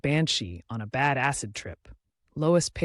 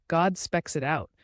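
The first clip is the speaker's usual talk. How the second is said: ordinary delivery, nothing marked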